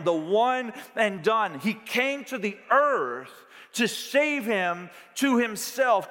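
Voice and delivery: preacher proclaiming Christ's sacrifice with fervour; fervent preaching. Acted. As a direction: build it up like a revival climax. Preaching rises to full force.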